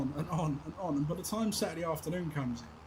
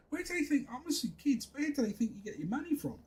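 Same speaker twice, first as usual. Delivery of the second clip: exasperated; 11 semitones higher than usual. Real, not acted. Exasperated rant about kids.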